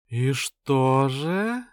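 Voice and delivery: slow, breathy, drawn-out question; languid purr. Acted. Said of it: "томным голосом произнесла блондинка".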